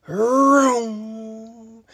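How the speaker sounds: long held engine noise; engine vroom. A narrator's reading. Dump truck racing round a curve, drawn-out vroom. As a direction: vroom that trails away.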